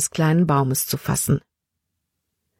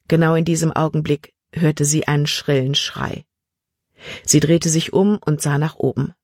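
usual speaking voice

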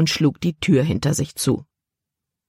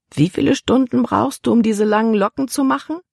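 curious; 7 semitones higher than usual. A narrator's reading. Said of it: "schien nun echt neugierig".

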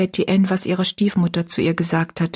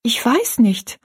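put-on higher, mildly fearful girl's answer; timid; 5 semitones higher, 3 dB louder, slower than usual. Rosalie answers her stepmother's sharp question, timidly. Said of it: "antwortete Rosalie furchtsam".

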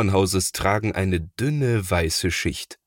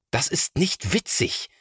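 annoyed; 8 semitones higher than usual. A narrator's reading. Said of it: "sagt Thomas ärgerlich".

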